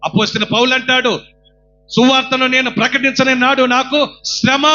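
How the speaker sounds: loud, high preaching cry; fervent sermon shout; full-force preaching. Acted. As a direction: exhort the crowd with all your voice.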